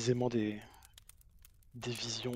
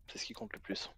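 whispered conviction; 8 dB quieter than usual. Acted. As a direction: breathe it out in a whisper. Whispered closing line.

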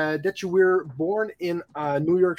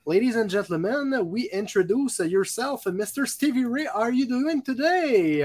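ceremonious, higher-pitched introduction; announcer's guest introduction; 6 semitones higher than usual. Real, not acted. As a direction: present the star guest to the audience, building up.

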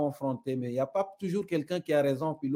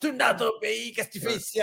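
mock rude local, pitched higher; mock hostile local; 7 semitones higher than usual. Shown as a demonstration. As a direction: play a hostile local telling him to go home. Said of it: "tu peux me dire". (hostilité jouée, voix plus aiguë)

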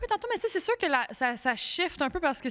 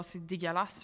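low-pitched, softer disgusted remark; odd low voice; 5 semitones lower, 6 dB quieter than usual. Real, not acted. Judging fast-food work at McDo, odd low disgusted voice. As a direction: drop low and quiet on "dégueulasse". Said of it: "pourquoi je parle comme ça?".

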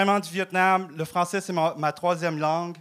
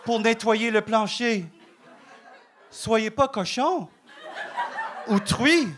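a higher, hammed-up Québécois accent; mock Québécois accent; 4 semitones higher than usual. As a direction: turn into a pure-laine guy from Lac-Saint-Jean, a touch higher. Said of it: "j'ai perdu mon accent".

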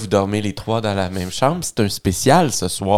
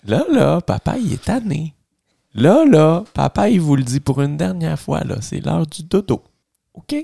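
exasperated dad; 6 semitones higher than usual. Acted. Dad scolding kids one last time, higher and fed up. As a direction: put your foot down like a tired dad.

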